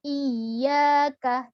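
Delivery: Iya with the y held and pressed; correct iyyaka. Shown as a demonstration. Teacher showing the joined stressed form. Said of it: "Dan diberi tekanan".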